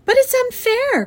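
high, loud wail of protest; crying out. A narrator's reading. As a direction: cry out like a wronged child. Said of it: "cried Fern".

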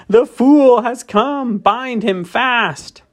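high, slow, gloating witch voice; fierce witch. A narrator's reading. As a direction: savour each word like a cruel witch. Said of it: "gave a wild, fierce laugh".

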